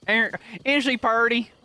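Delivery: high southern drawl of admiration; southern accent. Acted. Praising her horse like a Southern farmer, Southern accent. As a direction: coo over the horse in a rural Southern accent. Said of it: "I pull out the southern accent".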